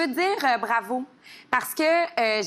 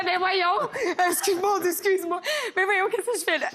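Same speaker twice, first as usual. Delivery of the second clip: flustered high apology; 9 semitones higher than usual. Retold or imitated. Retold flustered apology at audition.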